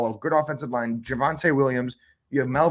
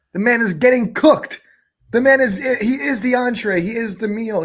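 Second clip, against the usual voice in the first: much higher and louder, building mockery; worked-up rant; 9 semitones higher, 7 dB louder than usual. Real, not acted. Fantasy host roasting Russell Wilson to co-host, higher and louder. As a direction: ramp up the roast like you can't believe it.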